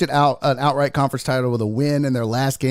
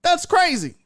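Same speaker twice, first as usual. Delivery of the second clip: stunned excitement; 11 semitones higher than usual. Retold or imitated. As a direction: blurt it out, too amazed to care. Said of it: "I was like".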